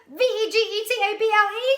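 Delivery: racing the clock, very fast; frantic fast spelling. A narrator's reading. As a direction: rattle letters off high and loud. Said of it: "spelled as fastly as he could".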